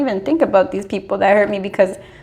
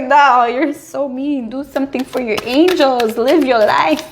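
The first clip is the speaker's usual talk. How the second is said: higher, slow chiding angel voice; mock-stern little angel; scolding angel; 5 semitones higher, 4 dB louder, slower than usual. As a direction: tell off the lazy one, drawn out.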